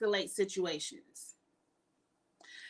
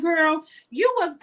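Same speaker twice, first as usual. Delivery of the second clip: put-on loud, high "girl, you was" with attitude; sassy clapback; 7 semitones higher, 9 dB louder, slower than usual. To Irene, who claims fear, a loud sassy retort. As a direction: tell Irene off to her face, sassy. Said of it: "I'm like".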